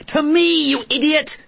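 high angry shout. Acted. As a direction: scream it at him furiously. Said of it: "shouted Kirsty".

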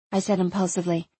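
plain reading voice

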